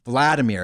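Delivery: Vladimir as VLAD-uh-meer, first syllable stressed; English-style stress. Shown as a demonstration. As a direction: hit VLAD at the start of Vladimir. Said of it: "It's not Vladimir".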